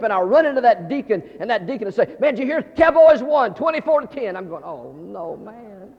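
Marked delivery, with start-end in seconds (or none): 2.2-4.4 high, loud blurted sports news; excited deacon. Retold or imitated. Deacon eagerly blurting the score. 4.6-5.9 deflated groan trailing off softer; mock dismay. Fan hears spoiler, acted dismay.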